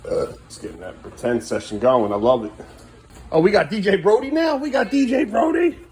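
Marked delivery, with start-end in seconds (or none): 3.3-5.8 high, rising excited questions; mock-amazed, pitch soaring at the end; playful hype. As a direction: hype up the little DJ, climbing higher. Hyping child's pretend DJ session.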